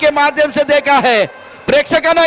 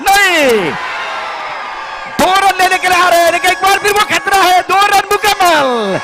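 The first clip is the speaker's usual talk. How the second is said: excited cricket commentary; 5 semitones higher than usual. Real, not acted. Catch chance, then winning runs.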